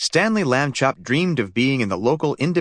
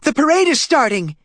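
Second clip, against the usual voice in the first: very high, louder excited squeal; 5 dB louder than usual. A narrator's reading. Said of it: "squealed Stanley's mother".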